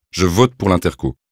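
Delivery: usual speaking voice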